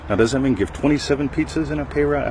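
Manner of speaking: normal talking voice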